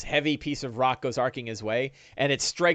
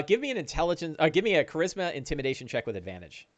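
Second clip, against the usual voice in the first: ordinary talk, nothing marked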